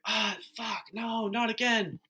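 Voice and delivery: high, pained mock wail of despair; the bone just broke a second time, high and anguished; despairing groan. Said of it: "it's like".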